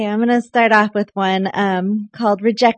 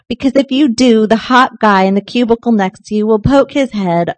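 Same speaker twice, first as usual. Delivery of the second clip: lively, louder mock-advice reading; poetry reading; 3 semitones higher, 7 dB louder than usual. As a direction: hand down silly wisdom to a kid. Reads comic advice poem.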